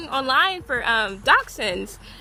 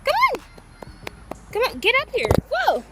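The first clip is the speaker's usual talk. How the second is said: coaxing pet talk; 3 dB quieter than usual. Real, not acted. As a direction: lure the puppy up with a sweet voice. Coaxing the dog onto the couch.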